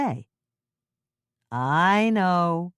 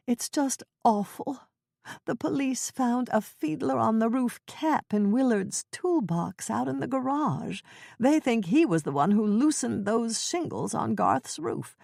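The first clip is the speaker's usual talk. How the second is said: put-on Ethel's fretful old voice; 6 semitones higher than usual. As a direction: quaver it like a flustered old neighbor. Ethel voiced in cozy mystery.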